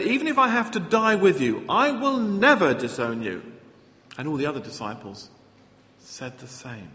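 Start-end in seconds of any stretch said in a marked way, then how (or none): 0.0-3.4 louder, firm insistence; loud declaration. Acted. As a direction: declare it with full conviction. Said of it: "Peter declared".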